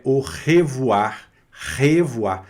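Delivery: "o revoar" with a scraped guttural R; throat R. Shown as a demonstration. Teacher repeats the farewell for students, "revoar" scraped in the throat. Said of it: "sempre arranhando na garganta".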